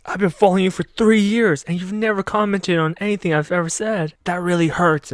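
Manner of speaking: high-pitched whining fan, imitated; mock jealous. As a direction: complain high, like a wounded devotee. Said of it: "that makes him really jealous".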